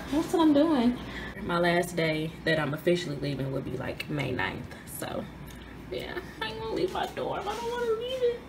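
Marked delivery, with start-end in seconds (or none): none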